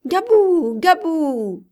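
put-on high, loud excited little-girl call; child's shout. A narrator's reading. Little girl running to the commandeur, shouting his name. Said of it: "en criant".

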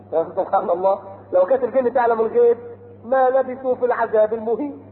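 ordinary delivery

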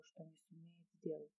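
ordinary delivery

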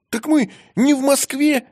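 bright cry of joy; delighted. A narrator's reading. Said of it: "обрадовался Рыжий".

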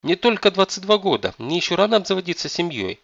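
high, loud protesting yelp; young man wailing in protest; indignant wail. A narrator's reading. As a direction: cry out in outraged disbelief. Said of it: "искренне возмутился Дживон и завопил".

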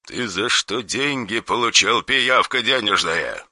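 soft, cold menace; quiet threatening caller on the phone; dry harsh voice. Acted. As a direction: threaten without raising the voice.

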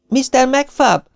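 very high, involuntary cry; calling out. A narrator's reading. Maisie crying out for McFab almost against her will. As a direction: call into the dark, pitch thrown high. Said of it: "kiáltotta szinte akarata ellenére".

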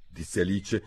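plain reading voice

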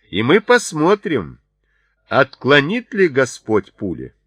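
loud, high defiant challenge; shouting. A narrator's reading. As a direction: shout a dare to test their faith. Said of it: "снова закричал он".

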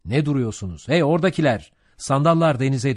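loud, high captain's shout; roaring command to the crew. A narrator's reading. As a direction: bellow orders across the deck. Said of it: "Ahab güverteye doğru bağırdı".

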